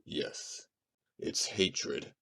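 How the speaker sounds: very soft, hushed voice; grave admission. Acted. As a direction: reveal it quietly and gravely. Grave revelation of the motive.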